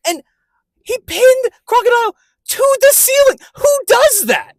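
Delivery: high, loud, incredulous hype; blown-away fan yelling; awestruck excitement. Real, not acted. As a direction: marvel at it at the top of your range. Hyped retelling of a favourite fight.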